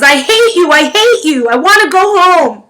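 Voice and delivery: loud, high tantrum cry; a child wailing his hatred; sobbing yell. A narrator's reading. Boy wails at the teacher holding him back. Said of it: "Jamie sobbed".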